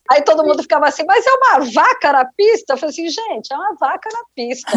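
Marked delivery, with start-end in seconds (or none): 1.1-2.7 high-pitched, incredulous question; retold disbelief. Crew baffled by a cow on the runway, incredulous. 3.1-4.7 raised pitch, much softer statement; retold hushed confirmation. Telling colleagues about the cow on the runway, hushed amazement. Her own quieter reply, same words.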